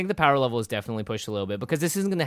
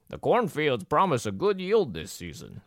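slow, lightly voiced old farmer; 3 semitones higher, slower than usual. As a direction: read it like a weathered old farmer. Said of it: "That was a good farmer voice".

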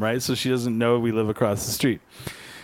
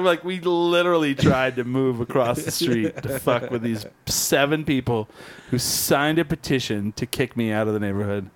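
ordinary delivery

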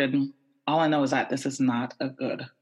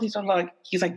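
ordinary delivery

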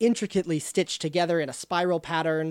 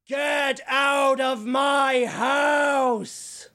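higher, slow drawn-out booming yell; booming shout; 7 semitones higher, much slower than usual. Acted. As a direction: boom it out like a haunting. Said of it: "comes a booming voice, and it shouts".

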